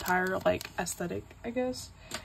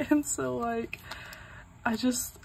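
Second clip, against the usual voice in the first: ordinary talk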